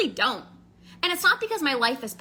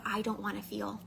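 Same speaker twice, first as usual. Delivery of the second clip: quiet; 4 semitones lower, 10 dB quieter than usual. Retold or imitated. Voicing a numbed inner thought.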